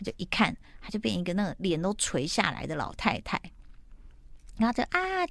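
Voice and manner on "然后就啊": high mock scream; half-hearted high shriek; retold scream. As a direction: squeal it out sharply as if in shock. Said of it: "尖叫".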